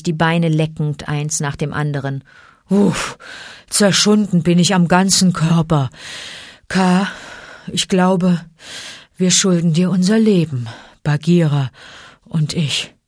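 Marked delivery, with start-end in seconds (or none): 2.7-12.9 weary grumble in short, halting phrases; grumbling bear. A narrator's reading. Battered bear thanks the python, grumbling. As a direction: groan and grumble, pausing between thoughts. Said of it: "brummte Baloo".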